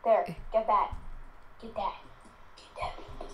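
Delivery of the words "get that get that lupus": whisper. Acted. Whispering to the pet.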